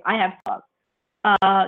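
normal talking voice

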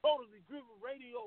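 distant caller far from phone; phone held away. Real, not acted. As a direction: voice faint off the mic. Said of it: "I can barely hear you".

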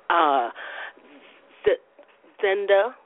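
stumbling over a name with long gaps; tongue-tied. Real, not acted. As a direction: get stuck on the name, halting and retrying. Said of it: "tongue-tied this morning".

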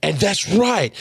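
proudly pointing out the change; retold excited praise. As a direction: celebrate the breakthrough as you retell it. Acts out praising her normal voice.

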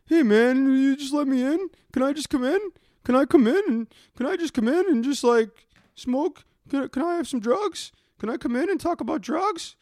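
begging drug fiend. Retold or imitated. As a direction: beg to be let in like a strung-out addict. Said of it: "And they're like".

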